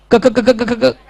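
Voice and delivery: stuttering on क over and over; mock stammer. Teacher faking a student stuck on the word, mock stammer. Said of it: "घटिया acting".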